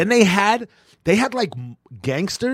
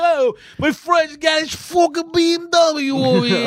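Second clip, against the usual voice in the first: very high, loud hammed-up yell; mock high shout; 4 dB louder than usual. Retelling the tire shop, his own reply as a high shout. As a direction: squawk it out like a cartoon. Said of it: "And I'm like".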